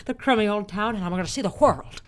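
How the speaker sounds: loud, fervent old-movie hero impression; George Bailey. Shown as a demonstration. Urging sweetheart to come along, old-movie hero impression. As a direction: build to an impassioned film-hero outburst. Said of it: "I can do George Bailey great".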